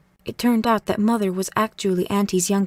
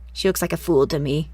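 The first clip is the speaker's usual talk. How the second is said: a hint of sour old woman, lower; lightly voiced cranky granny; 4 semitones lower than usual. A narrator's reading. Granny dismisses the new girl, mildly grumbling. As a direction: grumble it dismissively, a little low.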